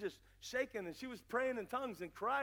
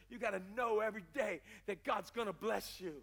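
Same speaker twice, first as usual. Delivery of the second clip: normal talking voice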